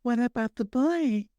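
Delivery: high, slow Southern belle impression; Alice. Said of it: "A Southern Belle from".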